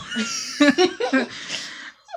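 high-pitched burst of laughter. Real, not acted. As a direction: break into a high giggling laugh. Laughing at sister.

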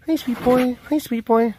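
high sweet cooing. Real, not acted. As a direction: coo to a pet dog. Cooing at pet.

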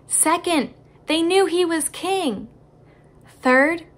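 ordinary talk

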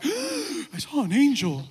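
mock gasp, then high-pitched wonder; hammed-up starstruck awe; awestruck human. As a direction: act amazed at seeing an angel. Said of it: "in awe of us".

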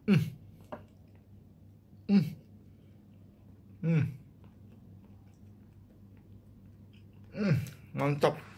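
chewing while humming; closed-mouth appreciative hum; mouth full of bread. Real, not acted. Seen 0: Bites bread just before; closed-mouth chewing with full cheeks while humming.

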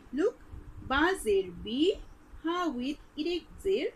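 ordinary delivery

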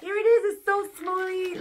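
excited. Real, not acted. Unboxing the new camera.

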